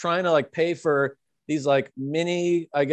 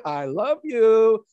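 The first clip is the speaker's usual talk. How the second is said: slow, sweet imitation of a pleading mother; sweet soothing mother; 5 semitones higher, 5 dB louder, much slower than usual. Imitating the mother calming the drunk man, slow and sweet. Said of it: "she was super sweet and just kept going".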